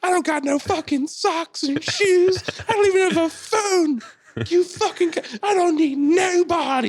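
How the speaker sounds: very high, loud mock tirade; screaming woman. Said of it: "And she's screaming".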